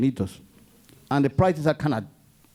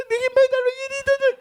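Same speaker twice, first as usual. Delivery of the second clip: mock wailing cry. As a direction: blubber it out like a crying child. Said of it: "I'm already crying".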